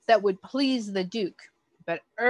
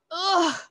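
high disgusted groan; disgusted ugh; 9 semitones higher than usual. A narrator's reading. Duchess tastes the roll, disgusted ugh. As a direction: recoil from a bad bite. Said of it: "cried the Duchess".

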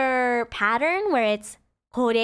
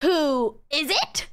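slow English gloss, rising at the end; slow translation; 3 semitones higher, much slower than usual. Shown as a demonstration. Teacher slowly glosses the question for learners. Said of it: "or if you change kare with dare".

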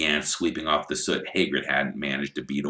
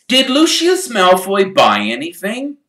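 higher, louder and pointed; sharply; 11 dB louder than usual. Acted. Mr Weasley overhearing about Malfoy, sharp and loud. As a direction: demand it at once. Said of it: "said Mister Weasley sharply".

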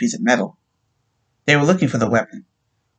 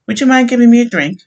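widow's request; 11 semitones higher, 7 dB louder than usual. A narrator's reading. Widow asks sergeant for drink.

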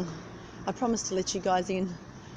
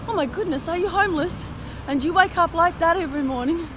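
surprised stranger; 7 semitones higher than usual. Retold or imitated. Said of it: "And he said to me".

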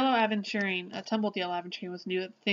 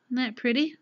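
normal talking voice

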